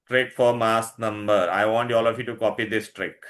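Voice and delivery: normal talking voice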